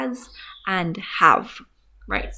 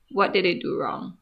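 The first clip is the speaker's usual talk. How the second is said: ordinary talk, nothing marked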